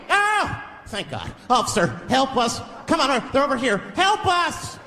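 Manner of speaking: high, panicked plea; frantic bystander. Retold or imitated. Frightened stranger begging a supposed cop for help, high panicked. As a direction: beg the officer in a high panic. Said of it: "Somebody might run up on me".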